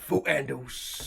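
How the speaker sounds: slow, drawn-out mock London "Fuck handles"; London accent. Shown as a demonstration. Teasing the mates back with a slow London accent. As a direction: lay on a London accent, slowly. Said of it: "your brilliant London accent".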